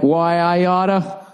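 slow, drawn-out mock tough guy; tough-guy threat. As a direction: growl it out like an old cartoon bully. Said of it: "we're like".

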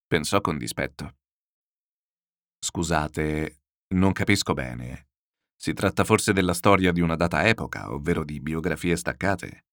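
plain reading voice

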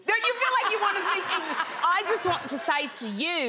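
London Cockney, dropped consonants; Cockney accent. Shown as a demonstration. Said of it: "That's such a Cockney London accent".

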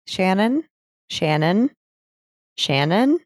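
"Shannon" with rising tone, spaced by pauses; questioning call. Retold or imitated. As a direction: say each "Shannon" as a check for response. Retells dispatcher calling silent caller.

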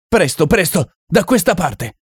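high, hurried call to follow; urgent shout. Acted. Harvey Deacon calls the others to safety, urgent shout. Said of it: "urlava Harvey Deacon".

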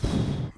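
long blown-out sigh; overwhelmed exhale. Retold or imitated. Recalling being in over his head at training, overwhelmed sigh. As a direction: let out a heavy puff of breath.

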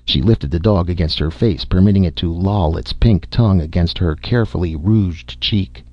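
ordinary delivery, nothing marked

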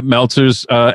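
ordinary talk, nothing marked

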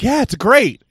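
high, loud, lazy praise; mock bored approval. Friends brushing off his draft with empty praise, mocked high and loud. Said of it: "they're just like".

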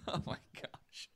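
breathless whispered giggle; whispering through a giggle at a friend's showing off; whispered laugh. Real, not acted. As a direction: laugh it out under your breath. Friend shows off his body.

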